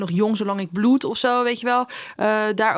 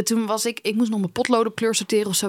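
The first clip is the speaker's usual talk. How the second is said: normal talking voice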